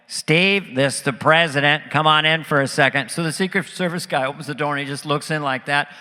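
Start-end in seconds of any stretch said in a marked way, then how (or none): 0.1-3.1 yelled presidential impression; George Bush. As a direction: call out to the guard as the president, loud.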